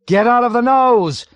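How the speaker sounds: very high, very loud frustrated shriek. A narrator's reading. Pilot shrieks at navigator in frustration. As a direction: shriek it at the end of your rope. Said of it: "Yossarian shrieked in frustration".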